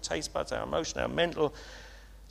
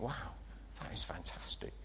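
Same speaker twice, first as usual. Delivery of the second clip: hushed, whispered wow, much softer; awed whisper; 6 semitones lower, 11 dB quieter than usual. Pretend. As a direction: breathe it out in quiet wonder. Imagined guests tasting the wine.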